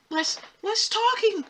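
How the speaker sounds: high, drawn-out plea; exasperated pleading. Real, not acted. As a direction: stretch each phrase out, high and pleading.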